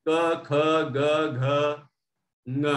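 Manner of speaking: क ख ग घ ङ sounded from the throat, spaced out; throat letters demo. Shown as a demonstration. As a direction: chant the row from the back of the throat. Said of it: "यहाँ कंठ से आवाज आएगी". (कंठ से अक्षरों का उच्चारण)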